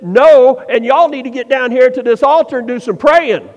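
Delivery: loud, commanding preacher's rebuke; forceful scolding order to the crowd; firm refusal. Acted. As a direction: lay down the law, punching the NO. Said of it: "He should have said".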